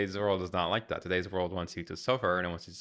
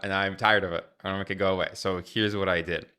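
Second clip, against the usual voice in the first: ordinary delivery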